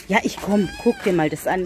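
normal talking voice

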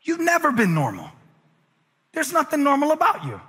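lifted pitch, never stressed. Shown as a demonstration. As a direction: hit never up high. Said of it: "tell 'em stronger".

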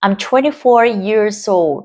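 put-on slowly, linking years into old; slow linked repeat. Shown as a demonstration. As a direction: spell out the linking, slow and clear. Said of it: "có hiện tượng nối âm ở đây". (đọc chậm, nối âm rõ ràng)

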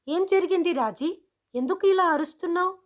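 questioning teacher. A narrator's reading. Teacher questions the shouting pupil.

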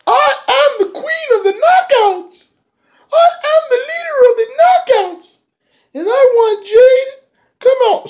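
cackling squeaky queen demanding Jade; shrill mock diva. [name] calls out Jade in ring.

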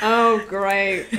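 put-on a light British accent on oh great; lightly voiced British accent, mock unimpressed. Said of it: "That's my best British accent".

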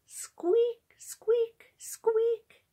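very high squeaks; high-pitched pump noise, word by word; twanging pump squeak. A narrator's reading. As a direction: imitate a squeaky water pump. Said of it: "twanged the pump".

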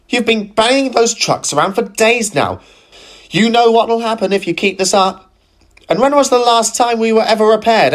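scolding. Acted. Gladys scolds Philip.

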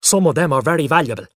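loud, fast, indignant father; cross. A narrator's reading. Father defending his souvenirs, cross. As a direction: rattle it off crossly. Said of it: "he said crossly".

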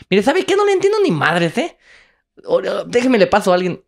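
high, loud, fed-up yelling; exasperated shouting. Retold or imitated. Frustrated traveler shouts into the phone at the Korean mother. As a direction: yell it like you've given up understanding.

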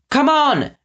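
high urgent cry; cried out. Acted. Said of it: "X cried".

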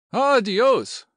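high, loud cry of wonder; rapturous exclamation. Acted. General smitten by the house and lady, exclaiming in rapture. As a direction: burst out, overcome with admiration. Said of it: "breathed General Falcone".

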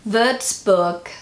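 Würzburg with ü and an ending like "book"; German-style pronunciation. Shown as a demonstration. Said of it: "I feel like that sounded good".

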